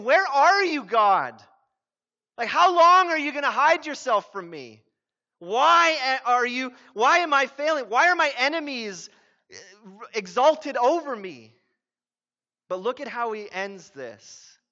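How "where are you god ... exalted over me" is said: louder and higher, pleading why and how long; raised, pleading voice of a man who feels abandoned; anguished cry to God. Acted. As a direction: cry it out like a desperate psalmist calling to God. Pastor voicing David's anguished cry to God.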